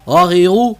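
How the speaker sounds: غا غی غو said quick and clipped; short syllables. Shown as a demonstration. Teacher shows students the quick غا غی غو. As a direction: say غا غی غو without stretching. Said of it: "کھینچنا بالکل بھی نہیں ہے".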